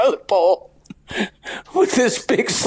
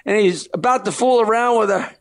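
normal talking voice

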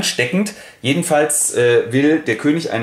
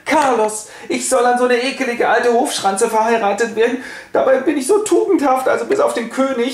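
very high, breathless-sounding put-on voice; swooning princess; 10 semitones higher than usual. Eboli flirts with Carlos, very high put-on voice. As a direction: coo it like a vain flirt.

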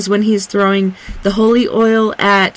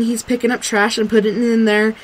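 normal talking voice

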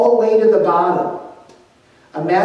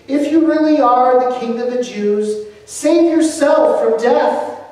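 higher, louder jeering taunt; 7 semitones higher, 5 dB louder than usual. A narrator's reading. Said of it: "They began to taunt him".

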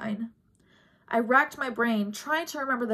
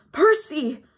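a loud, high scream of his name; 6 semitones higher, 7 dB louder than usual. A narrator's reading. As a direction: scream it out in alarm. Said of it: "Talia screamed".

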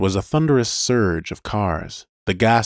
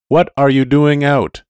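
high, loud demanding question; loud interrogation; 7 semitones higher, 8 dB louder than usual. Acted. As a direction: demand an answer. Police car interrogates.

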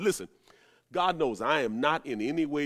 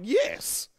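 a higher, scornful yes; disgusted yes; 7 semitones higher than usual. Retold or imitated. Mother answering her son's question, scornful yes. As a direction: scoff it like an insulted mother. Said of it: "in a way of disgust".

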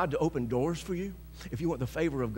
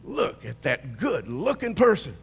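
higher and louder, rising to a cheer; excited self-talk; 6 semitones higher, 12 dB louder than usual. Shown as a demonstration. Believer talking to themselves in the mirror, exuberant. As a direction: hype yourself up in the mirror. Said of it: "walk into that mirror and say".

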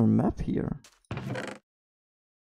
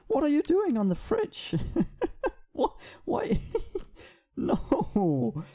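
laughing; 8 semitones higher than usual. Real, not acted. Laughing at the animals.

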